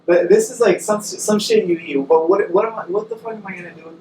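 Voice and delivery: ordinary talk, nothing marked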